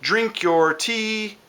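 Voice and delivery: towhee song imitation. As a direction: stretch it out slowly like a towhee. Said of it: "the song sounds like it says".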